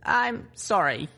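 high, slow filly apology; regretful. Acted. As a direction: say sorry slowly, with regret. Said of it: "I said regretfully".

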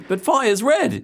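put-on high, slow childlike Australian protest; squeaky mock-naive objection. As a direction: act like a puzzled kid.

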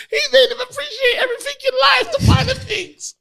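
high cracking laugh-talk; words squeezed out through laughter; laughing hysterically. Real, not acted. Laughing hysterically while talking.

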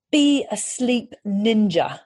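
put-on slow British-accented slogan; Catherine's British accent. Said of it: "I'm gonna try and do this in Catherine's accent".

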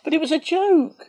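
indignant protest. Real, not acted. Protesting a teasing jab.

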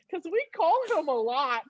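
put-on child's voice. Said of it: "And he's like".

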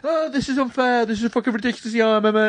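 high, fast petulant complaint; put-on tantrum whine, pitched right up; mock whining. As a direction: whinge high like a sore loser. Mimics complaint about unfair game.

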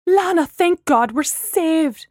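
loud, high, slow melodramatic cry; dramatic relief. A narrator's reading. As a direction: proclaim rescue with theatrical relief. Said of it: "Gemma leapt up dramatically".

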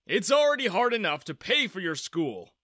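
a dad firmly dismissing the idea; stern dad. A narrator's reading.

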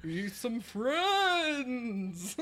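slow, drawn-out creepy voice; spooky voice. Shown as a demonstration. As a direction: stretch every word like a ghoul. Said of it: "you have to be like".

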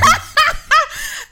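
loud, very high laugh breaking out mid-cry; high burst of laughter. Retold or imitated. Mid-cry on the phone to mum, a loud high laugh. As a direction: let a shrieking laugh spill out.

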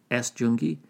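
ordinary delivery

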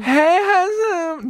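a very high, very slow, drawn-out hey, handsome; sing-song parody of a flirt at work; mock flirty come-on. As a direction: ham up a sleazy pickup line. Mocking co-host's flirty line.

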